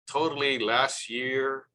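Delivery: ordinary talk, nothing marked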